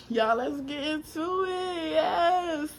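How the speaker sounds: soft, slow, voice breaking into tears; fighting back tears as she says it; choked up. Real, not acted.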